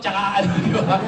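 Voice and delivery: normal talking voice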